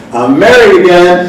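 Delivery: high, loud, slow happy announcement; gleeful reveal. Retold or imitated. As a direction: spill the big secret with glee.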